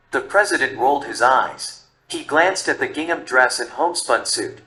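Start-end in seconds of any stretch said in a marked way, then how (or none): none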